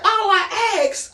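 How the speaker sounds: high, slow, drawn-out complaint; hammed-up exasperation; exasperated protest. Storyteller getting back to his point, exasperated.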